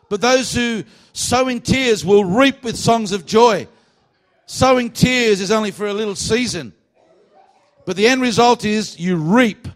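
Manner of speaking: loud, high, slow proclamation; forceful preaching. Real, not acted. Preacher promising a harvest after tears, loud emphatic preaching. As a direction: drive each phrase home with force.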